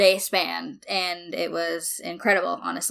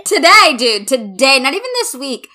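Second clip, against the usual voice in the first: much higher and louder, cheering; thrilled; 6 semitones higher, 8 dB louder than usual. Real, not acted. Congratulating a friend on promotion, thrilled cheer. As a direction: celebrate the news.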